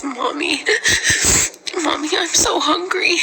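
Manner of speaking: high, tearful plea; crying child. Retold or imitated. Mother voicing her crying son, real tears in her voice. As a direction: sob it out like a starving toddler. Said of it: "And he was crying".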